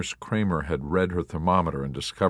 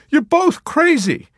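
alarmed cry; 9 dB louder than usual. A narrator's reading. Nurse Kramer imploring the ward, alarmed. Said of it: "glancing about imploringly".

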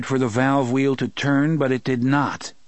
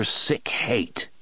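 ordinary delivery

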